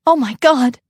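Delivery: high, loud shocked cry; horrified gasp. Acted. Mother hearing her son is gone, horrified. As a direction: hit the panic at once.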